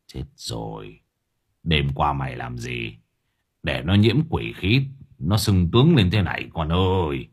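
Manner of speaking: low, hushed start rising to scolding; grim dismay. A narrator's reading. Old gangster questioning his underling about last night, grim. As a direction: sigh out the bad news gravely. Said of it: "Lão chặt lưỡi".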